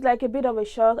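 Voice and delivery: ordinary delivery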